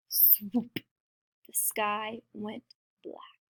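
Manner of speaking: soft, slow suspense; hushed narration. A narrator's reading. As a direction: drop the volume and let it hang. Hushed narration of sudden danger.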